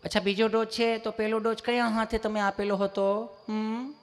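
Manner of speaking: put-on sweet, singsong nurse; imitated overly loving nurse; gentle nurse. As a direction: coo the question evenly, closing with a soft hum. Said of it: "તમે કેટલું પ્રેમથી પૂછો છો".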